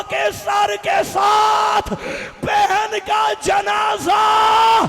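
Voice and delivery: high, loud, slow chanting cry; full-force wailing lament. Acted. Preacher leading mourners through Sakina's death and funeral, slow wailing lament. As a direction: stretch each phrase out into a mourning wail.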